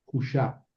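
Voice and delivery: "hu, shap" with a long u in "hu". Shown as a demonstration. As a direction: say "hu, shap" in two syllables, no v. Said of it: "la vocal u larga".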